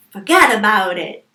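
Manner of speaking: loud, hammed-up Italian-American accent. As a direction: belt it out with some Italian on it. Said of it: "a little bit of Italian on there".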